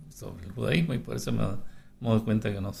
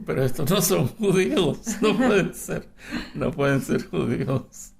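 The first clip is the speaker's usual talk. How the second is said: higher and louder, laughing through the words; laughing disbelief; 8 semitones higher, 9 dB louder than usual. Retold or imitated. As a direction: laugh while quoting your own shock. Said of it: "Yo digo".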